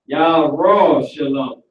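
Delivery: "Yahweh Shalom" very slow, yah-RAAAW-sha-lum; Hebrew pronunciation. Shown as a demonstration. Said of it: "That's how you say Jerusalem".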